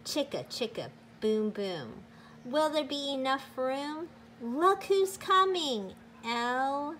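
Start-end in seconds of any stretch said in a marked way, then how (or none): none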